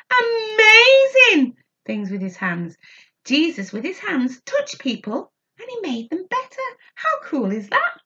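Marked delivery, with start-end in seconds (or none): 0.1-2.8 put-on slow, high and loud, showing the children how to say it; exaggerated call-and-repeat. Shown as a demonstration. Teacher leading children in repeating the phrase, slow and loud. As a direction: lead the kids in a big excited chant. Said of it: "Can you say".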